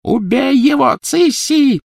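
high, loud frantic shriek; screamed order. A narrator's reading. Villainess ordering her sister to kill the elf, screaming. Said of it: "завопила Белатриса".

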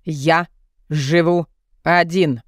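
Я ... живу один, each syllable hammered slow; staccato. A narrator's reading. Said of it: "По слогам отчеканил монстр".

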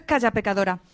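«Calla, pecadora» más fuerte, cortante; regaño fuerte. Acted. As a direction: manda callar a la rival como una beata escandalizada. Host scolds her rival on air. (la locutora regaña a su rival en antena, fuerte y cortante)

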